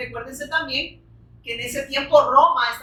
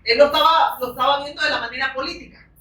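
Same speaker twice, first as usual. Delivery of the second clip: ordinary delivery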